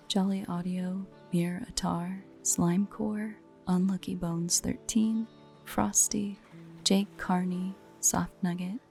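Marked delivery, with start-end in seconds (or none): none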